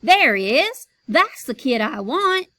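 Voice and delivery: loud, high excited call. Acted. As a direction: point and call it out. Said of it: "he pointed and called".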